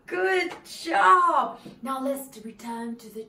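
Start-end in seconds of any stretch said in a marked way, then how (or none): 0.1-1.6 cheering. Real, not acted. Cheering the children on.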